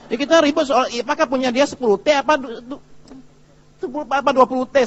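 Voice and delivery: high, loud, stumbling bickering; worked-up mock quarrel, pitched high; mock squabbling. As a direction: act out a heated argument over nothing. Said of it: "kita ribut soal duitnya orang lain".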